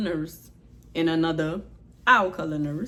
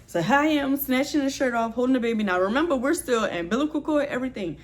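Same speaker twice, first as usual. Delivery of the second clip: normal talking voice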